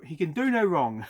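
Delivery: emphatic British-accented flourish. Real, not acted. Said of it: "said that in a British accent".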